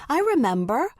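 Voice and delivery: high, bright lift of recognition; smiling realization. A narrator's reading. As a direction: suddenly recall it, pleased. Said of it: "Stevie smiled".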